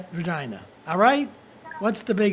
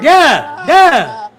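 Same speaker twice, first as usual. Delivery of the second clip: loud hype yell; 5 semitones higher, 13 dB louder than usual. Real, not acted. Hype shouting.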